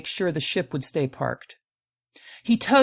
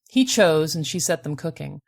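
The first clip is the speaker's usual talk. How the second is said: plain reading voice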